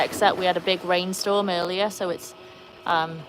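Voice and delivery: ordinary talk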